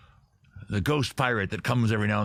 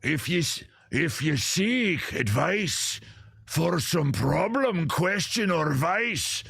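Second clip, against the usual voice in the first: higher, very slow, booming wizard proclamation; Tim the Enchanter; 6 semitones higher, much slower than usual. Acted. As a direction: intone it like a pompous Scottish enchanter. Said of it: "I'll read it like Tim the Enchanter".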